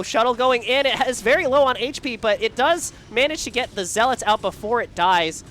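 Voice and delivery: loud, high fired-up commentary; excited play-by-play. Real, not acted. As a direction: call the action hyped up. Live play-by-play over game action.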